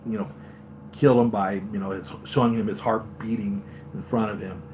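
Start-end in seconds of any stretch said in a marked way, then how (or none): none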